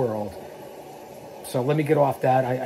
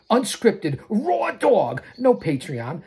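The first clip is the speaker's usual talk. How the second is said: usual speaking voice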